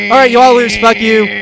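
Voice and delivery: loud, high mock yell at listeners; hammed-up angry dismissal; mock outburst. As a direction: shout them off in jest. Mock ends contest.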